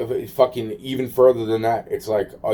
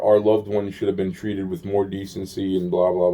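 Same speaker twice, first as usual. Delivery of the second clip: normal talking voice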